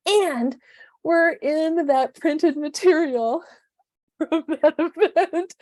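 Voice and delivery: thrilled to be in the printed material, slow high laughter; delighted laughter. Real, not acted. Delighted, laughing about printed photo.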